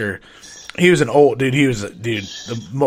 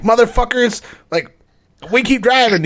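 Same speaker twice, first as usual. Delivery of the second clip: much higher, complaining crackhead on the phone; whiny crackhead; 9 semitones higher, 3 dB louder than usual. Retold or imitated. Crackhead calling pharmacy, high whiny complaint. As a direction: whine that you're still driving. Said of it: "they'd always call and be like".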